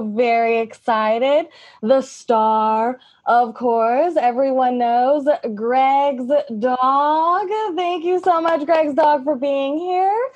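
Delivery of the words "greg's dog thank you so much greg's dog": ordinary delivery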